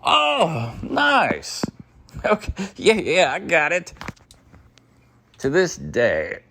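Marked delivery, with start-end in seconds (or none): 0.0-3.9 playing along. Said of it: "I'm just kinda playing along".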